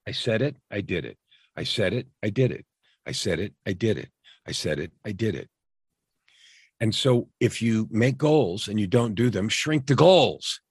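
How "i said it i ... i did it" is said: even, falling beat on "I said it, I did it"; steady cadence. Shown as a demonstration. Coach demonstrating the cadence of success, in a measured falling rhythm. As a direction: pair "I said it" with "I did it", each dropping away, softer. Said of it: "cadence of success goes like this".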